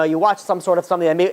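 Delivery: normal talking voice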